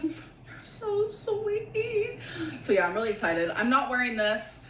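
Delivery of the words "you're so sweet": crying out the thanks, words breaking; teary reply. Retold or imitated. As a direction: voice jumps high, choked with tears. Retelling her tearful reply to mom.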